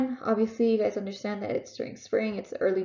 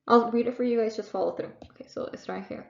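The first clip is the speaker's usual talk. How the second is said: usual speaking voice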